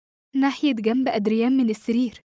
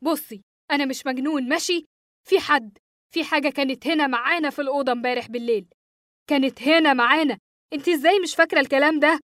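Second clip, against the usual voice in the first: raised, higher-pitched insistence; acted outburst, desperate to be believed; exasperated shouting; 4 semitones higher than usual. A narrator's reading. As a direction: protest heatedly without a full yell. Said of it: "صرخت فيها".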